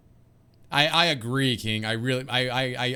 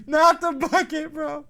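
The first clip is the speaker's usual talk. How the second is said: anguished protest; 13 semitones higher, 7 dB louder, slower than usual. Real, not acted. Protesting the bucket's loss.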